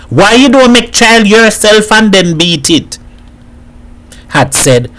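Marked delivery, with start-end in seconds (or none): 0.1-3.0 high-pitched shouted taunt; defiant shout. A narrator's reading. Freed Boy shouts back at Edward, defiant shout. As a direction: shout it back, much higher. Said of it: "he shouted to Edward".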